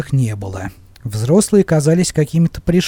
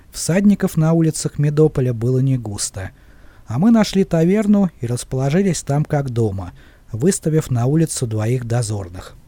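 ordinary delivery, nothing marked

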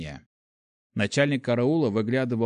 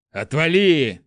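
loud, high angry shout; angry brush-off; 5 semitones higher, 8 dB louder, slower than usual. Acted. Prince shaking off his loyal comrade, angry shout. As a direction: shout him away.

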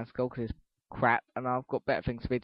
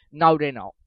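ordinary delivery

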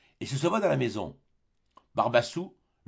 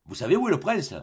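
higher, louder eager ask; eager question; 7 semitones higher, 4 dB louder than usual. Acted. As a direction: jump on the news. Tartarin asks captain.

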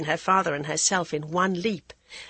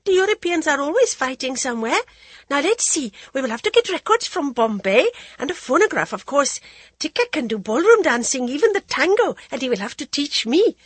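high, loud, tumbling with delight; gushing like an excited girl; overjoyed; 8 semitones higher, 5 dB louder than usual. A narrator's reading. Jaya to her father, high breathless excitement. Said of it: "her incoherent plans".

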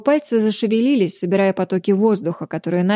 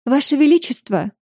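higher, fawning royal greeting; 6 semitones higher than usual. Acted. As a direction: grovel sweetly to the king. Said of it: "тут же залебезила леди Мансера".

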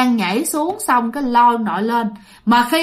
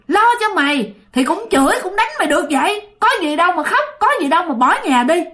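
higher-pitched, insistent mock telling-off; retold scolding; 5 semitones higher than usual. Rescuer lecturing a runaway child after saving him, acted scolding. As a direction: act out an elder scolding a child, pitched up throughout. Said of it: "Mới la ông nội tiếp".

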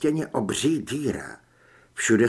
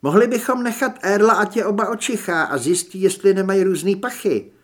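higher, lightly voiced Julie suggesting a plan; higher, louder character suggestion; Julie's character voice; 5 semitones higher, 5 dB louder than usual. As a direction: pitch it up as Julie proposing a test.

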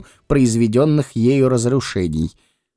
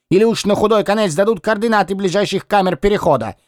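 cheeky Lis voice; 8 semitones higher than usual. Acted. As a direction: needle the captain in a high voice.

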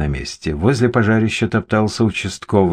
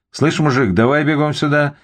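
calling out; 7 semitones higher than usual. A narrator's reading. Policeman summons the guard, calling out. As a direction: call over a passer-by, mildly raised voice. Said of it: "крикнул ему участковый".

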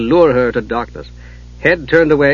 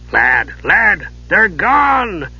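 calling out; 6 semitones higher, much slower than usual. Acted. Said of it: "Dugan called".

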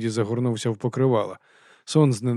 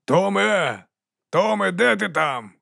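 higher call, slow and uneasy; irritated, worried call; 8 semitones higher, slower than usual. Acted. Nick calls for the missing Tom, annoyed and worried. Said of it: "чутно було роздратування і стурбованість".

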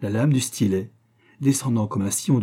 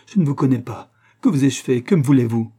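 louder, pleading questions; distraught; 3 semitones higher, 7 dB louder than usual. A narrator's reading. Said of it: "tout éperdue". (éperdue, suppliante)

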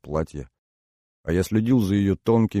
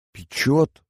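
higher, thin squeak; 8 semitones higher than usual. Acted. Inna feels the burning, squeaked. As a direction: squeak it out in surprise. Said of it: "пропищала Инна".

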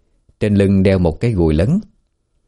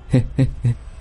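eerie soft cackle; 5 semitones higher, 5 dB quieter than usual. A narrator's reading. Madwoman chanting by the stream.

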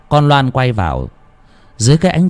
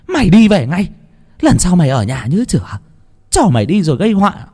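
a shaken mother snapping at her son; angry scolding mother; 8 semitones higher, faster than usual. A narrator's reading. Mother scolds child home.

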